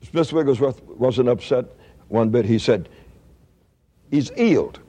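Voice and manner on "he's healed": "He's healed" with both H's dropped. Retold or imitated. Wigglesworth unbothered by the doctor, "He's healed" without any H. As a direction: say "He's healed" as 'e's 'ealed. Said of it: "he didn't pronounce H's".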